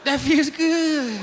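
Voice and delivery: high, drawn-out pleasure squeal; slow, high mock moan of ecstasy; acted moan of pleasure. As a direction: moan it high, stretching the words. Mimics man with two afflictions.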